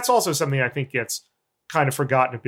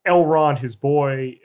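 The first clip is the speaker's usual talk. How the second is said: usual speaking voice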